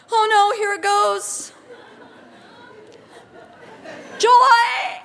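a loud, high burst of laughter through the words; laughing with joy. Real, not acted. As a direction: let the laughter break through as joy takes over. Joy overwhelms the speaker.

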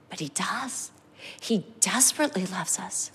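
tender reassurance. Real, not acted. Pastor reassuring the congregation, very softly. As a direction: soften right down to reassure.

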